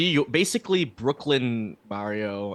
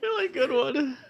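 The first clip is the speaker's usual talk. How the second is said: laughing. Real, not acted. Laughing at a joke.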